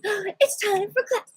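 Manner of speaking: high, sing-song announcement to a cat; high playful call, trailing into da-da-das; excited mimicry. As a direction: re-enact it in a high, playful chirp. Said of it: "And I went like this".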